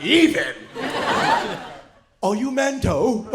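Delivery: incredulous, drawn-out British mimicry; mock British accent. Comic rejecting a British man's claim, mock British accent. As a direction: throw it back in a broad British accent. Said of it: "I get the accent".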